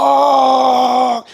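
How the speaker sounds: a high, loud, raw scream of one word; screamed curse. Retold or imitated. Teller reenacts his scream of rage in the haunted house: raw high yell. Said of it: "screamed aloud in my, uh, top of my voice".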